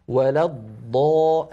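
"ولا الض" slow, dad held before release; prolonged first dad. Shown as a demonstration. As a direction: dwell on the still dad in "الض". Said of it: "أتكلم على الضاد الأولى".

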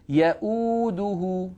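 يؤوده with the uu held long and slow; Quran recitation. Shown as a demonstration. Teacher reciting Ayat al-Kursi word slowly. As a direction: chant يؤوده stretching the madd. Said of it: "هذا مد بدل بمقدار حركتين".

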